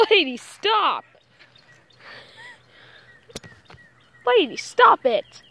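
high, loud pet scolding; scolding a dog. Real, not acted. Vlogger orders the dog Lady to stop, loud scolding. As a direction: call the dog off sharply.

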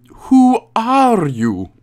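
high, very slow drawn-out question; dramatic questioning. Acted. Gamer questions the woman in the cell, dramatic drawl. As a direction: stretch each word in mock drama.

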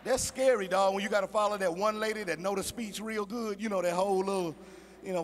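ordinary delivery

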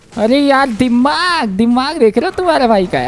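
high-pitched excited bragging; excited boast. Real, not acted. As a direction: show off your cleverness, pitch lifted. Boasting over his trick in game.

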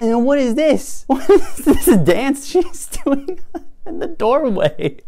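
high, amused and incredulous through laughter; laughing disbelief. Real, not acted. Laughing at a weird dance.

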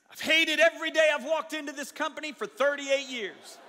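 loud, high declaration; retold bitter confession. Engineer to coworkers at his work anniversary, loud complaint. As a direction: announce it loudly to the whole office.

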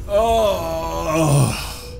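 long, drawn-out whining groan; frustrated groan. Real, not acted. Player who just drowned, groaning. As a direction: moan it out in defeat.

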